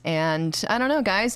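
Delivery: ordinary talk, nothing marked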